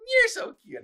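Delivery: high, loud cutesy echo; match her squeal; playful squeal. Retold or imitated. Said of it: "I go".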